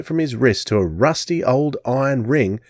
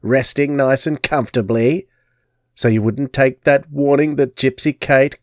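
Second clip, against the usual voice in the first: put-on higher, crackly old crone taunting; 4 semitones higher than usual. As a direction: gloat like a wicked fortune teller. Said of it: "she cackled".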